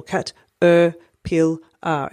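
caterpillar broken into syllables; syllables split apart. Shown as a demonstration. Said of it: "if she wants to spell caterpillar".

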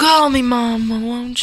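pleading Southern mama; Southern melodrama plea; Southern accent. Acted. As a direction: ask imploringly in a Southern accent. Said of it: "do this in a Southern accent".

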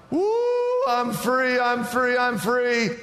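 high, jubilant whoop of freedom; elated celebrating. Acted. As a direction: crow it high like someone just set free. Acts out newly freed person celebrating.

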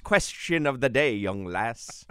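a hammed-up Irish brogue; comic Irish accent; mock Irish accent. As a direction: play a jolly Irishman greeting a young lass. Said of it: "That's Irish".

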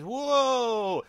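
crowd gasp. Retold or imitated. Crowd gasps at magic trick.

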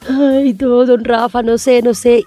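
crying. Retold or imitated. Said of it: "empecé a llorar".

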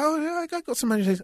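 a hint of a chirpy girl replying; brightly imitating the friend who said she had money; mock girl's answer. Friend quoted answering a question.

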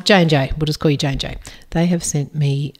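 ordinary talk, nothing marked

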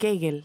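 Kegel with a hard g; own pronunciation of Kegel. Shown as a demonstration. Host admits she says it differently, Kegel with hard g. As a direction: say "Kegel" with a hard g, not the Spanish j sound. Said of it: "yo pronuncio Kegel".

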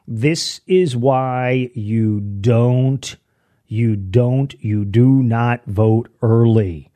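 This is why you don't... you do not vote early, drawn out very slowly, do not split apart; very slow repeat. Shown as a demonstration. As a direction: spell it out word by word for the slow folks. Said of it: "let me say it again for those people, the slow folks".

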